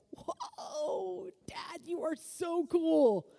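drawn-out, high whoa of wonder; awestruck child. Acted. Child admiring their parent, wide-open wonder. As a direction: marvel slowly like a kid at Dad. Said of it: "Like a child would do to their parent".

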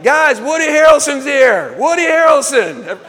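high, loud shout to the guys; excited announcement. Retold or imitated. Customer shows off lookalike to his buddies, excited announcement. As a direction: crow it out to a crowd of buddies.

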